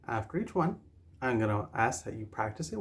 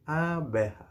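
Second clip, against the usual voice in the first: say-along model; 3 semitones higher than usual. Shown as a demonstration. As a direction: stretch "Abeja" so learners can join in. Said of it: "Say it with me now".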